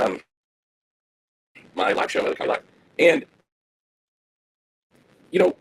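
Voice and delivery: choppy, broken-up stream audio; garbled audio. Real, not acted. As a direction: voice cutting in and out over a bad connection. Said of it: "my producer said that the audio's garbled".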